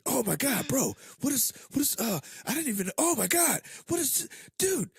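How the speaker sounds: very high, loud mock panic; imitating frantic texter. Friend mimics panicky movie texts, high frantic imitation. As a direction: stammer out a hammed-up, squealing freak-out. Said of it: "you were sending me those fucking, text messages".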